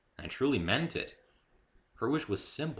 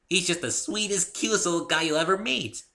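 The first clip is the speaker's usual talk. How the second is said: high, loud bouncy pony voice; bubbly pony; 10 semitones higher, 5 dB louder than usual. A narrator's reading. Pinkie explaining Spike to the newcomer, high and bubbly. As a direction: chirp it like a cheerful pony.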